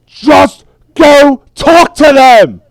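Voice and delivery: bellowed order with drawn-out words; frustrated yelling. Acted. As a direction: yell each word out slowly, at the end of your patience. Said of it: "he starts yelling".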